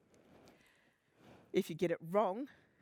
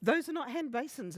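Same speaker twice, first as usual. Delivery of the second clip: flustered exclamation; 8 semitones higher, 4 dB louder than usual. Retold or imitated. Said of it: "they'll go".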